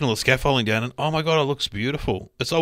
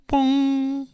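high, ringing imitated bell bong; 12 semitones higher than usual. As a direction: sing out the bong like Big Ben. Imitating Big Ben chime.

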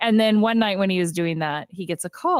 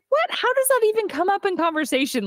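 high-pitched mock disbelief; incredulous; 12 semitones higher than usual. Woman questions her date's dance move, high mock incredulity. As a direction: question it in imitated, baffled astonishment.

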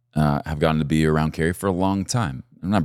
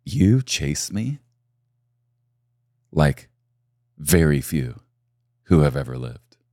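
tender voice of God; 4 semitones lower than usual. Acted. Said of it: "the Lord ... said".

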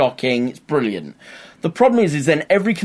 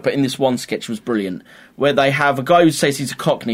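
ordinary delivery

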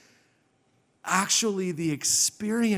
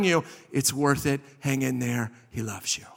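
tender reassurance; 3 dB quieter than usual. Retold or imitated. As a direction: fade gently into a quiet promise. Future self reassures, preacher drops voice.

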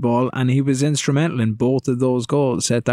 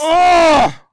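excited shout; 7 dB louder than usual. Real, not acted. Shot cleared off the line.

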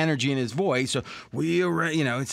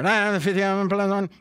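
quick auctioneer patter, pitched up; 6 semitones higher than usual. Pretend. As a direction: rattle off numbers like an auctioneer. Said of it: "I'll be like an auctioneer".

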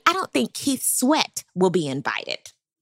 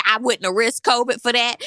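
usual speaking voice

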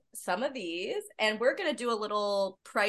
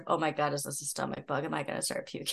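low, hushed worried aside; retold fear; 6 semitones lower, 9 dB quieter, faster than usual. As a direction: voice an anxious thought under the breath. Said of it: "it's always that fear of".

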